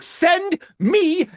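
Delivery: high, loud eager yell; eager shout. Retold or imitated. Begging the distributor for promo stuff, an eager shout. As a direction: demand it all at once, thrilled. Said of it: "And I was like".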